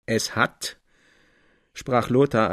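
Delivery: normal talking voice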